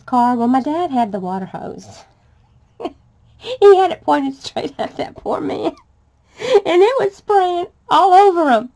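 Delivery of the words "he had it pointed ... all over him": higher and louder, breaking into laughter; laughing while telling. Real, not acted. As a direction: tell it through helpless laughter. Laughing at funny memory.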